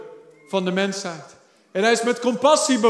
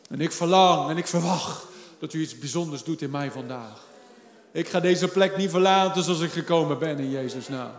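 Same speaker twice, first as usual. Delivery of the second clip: soft, lowered praying voice; hushed prayer; 6 semitones lower, 5 dB quieter than usual. Real, not acted. Preacher modelling a believer's prayer of expectation, soft and low. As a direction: pray it quietly and earnestly.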